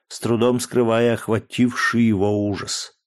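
plain reading voice

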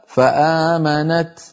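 slow فآمنت with a released final t; stop on ta. Shown as a demonstration. Teacher showing a stop, clear final t. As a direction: draw out فآمنت and end on ت. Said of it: "نقف بالتاء".

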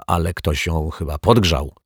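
ordinary delivery, nothing marked

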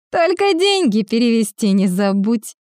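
ordinary delivery, nothing marked

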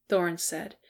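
ordinary delivery

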